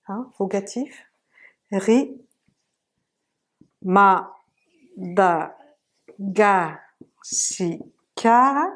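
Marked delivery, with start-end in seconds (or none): 3.8-8.7 "ma, da, ga, si, ka" with a pause after each; each syllable of "ma, da, ga, si, ka" alone. Shown as a demonstration. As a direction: break "ma, da, ga, si, ka" into separate beats. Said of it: "avoir un son".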